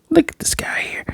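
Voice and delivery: hushed aside to the viewers; whisper. Real, not acted. Whispering to viewers about the guy on screen. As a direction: lean in and whisper it.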